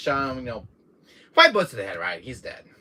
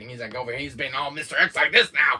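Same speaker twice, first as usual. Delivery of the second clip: ordinary talk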